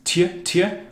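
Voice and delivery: tje with t and y run together; ty sound. Shown as a demonstration. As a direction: say tje, t melting into y. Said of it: "T Y together".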